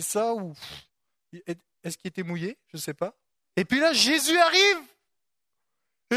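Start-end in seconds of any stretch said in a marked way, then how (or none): none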